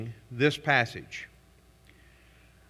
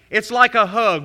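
higher, louder mock sweetness; sarcastic quip; 5 semitones higher, 9 dB louder than usual. Real, not acted. Pastor teasing congregation about sarcasm, mock sweet. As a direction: toss off the sarcasm like a joke.

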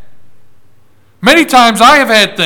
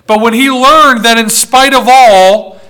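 ordinary talk, nothing marked

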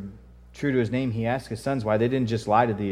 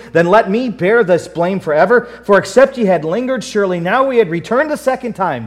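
loud, raised preacher's reading voice; forceful scripture reading; 7 semitones higher, 10 dB louder than usual. Acted. Preacher reading the sons' plea to their father, forceful. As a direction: declaim the verses with driving force.

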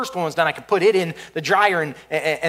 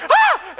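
high, louder yelp of fright; startled scream; 4 dB louder than usual. Retold or imitated. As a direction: shriek as the flames leap. Startled by flames.

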